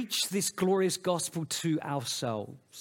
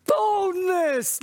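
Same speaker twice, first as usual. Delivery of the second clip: Boldness cried out high and loud, falling; emphatic boldness; 13 semitones higher, 5 dB louder than usual. Shown as a demonstration. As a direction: hit Boldness with full force. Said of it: "come to the throne with boldness".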